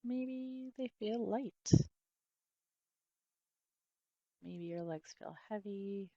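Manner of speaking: soft body-scan voice with long pauses; guided meditation. Real, not acted. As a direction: gently guide attention through the body, softly and unhurried.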